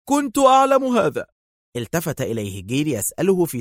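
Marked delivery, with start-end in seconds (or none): 0.1-1.3 much higher cry on أعلم; Dar's second cry, pitched very high; exclaiming louder. A narrator's reading. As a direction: Dar repeats it, climbing higher. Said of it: "هتف دار".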